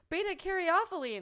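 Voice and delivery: "beta caryophyllene" sounded out carefully, high and loud, every syllable; careful pronunciation. Shown as a demonstration. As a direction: nail the hard word "beta caryophyllene" proudly. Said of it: "said it right".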